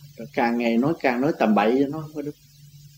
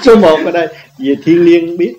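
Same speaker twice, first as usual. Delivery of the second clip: laughing; 4 semitones higher, 10 dB louder than usual. Real, not acted. Repeats the praise while laughing.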